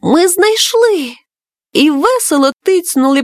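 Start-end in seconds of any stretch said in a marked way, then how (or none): 0.0-1.1 higher, drawn-out cry of discovery; children's shared exclamation, lightly voiced; excited exclamation. A narrator's reading. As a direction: call it out with mild storybook excitement. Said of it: "малюки в один голос вигукнули".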